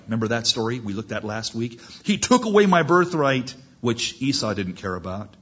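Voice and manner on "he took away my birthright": raised, louder acted complaint; indignant protest. Cheated brother protesting over his birthright, indignant. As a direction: repeat the grievance, pitched up.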